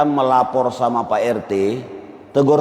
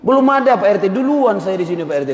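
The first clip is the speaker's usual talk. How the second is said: angry retort; 6 semitones higher than usual. Retold or imitated. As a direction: snap back at the RT with Medan fire. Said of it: "Hebat kali Pak R T ini ngomong kayak gitu".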